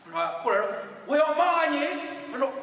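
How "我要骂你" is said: high loud angry yell; shouting. Acted. Boy yells at the echo, loud high shout. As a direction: yell it like a cross kid.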